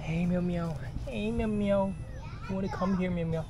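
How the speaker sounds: high sing-song call to the kittens; calling a cat. Real, not acted. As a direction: coax them over sweetly. Calling more cats.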